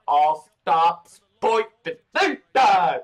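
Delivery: shouted mock station announcement; train conductor call.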